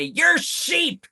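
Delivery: high-pitched mocking "You're sheep!"; mock jeer. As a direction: squeal it like a smug heckler. Mocking MAGA fans insulting liberals.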